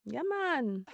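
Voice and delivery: high, soft mock Jamaican; badly faked Jamaican, kept small; fake Jamaican accent. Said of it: "That fake Jamaican".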